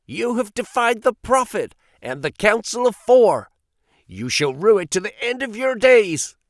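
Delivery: high, loud, furious warning; raging threat. A narrator's reading. Strangerson cursing Ferrier as he is thrown out, furious. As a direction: fling the curse at him.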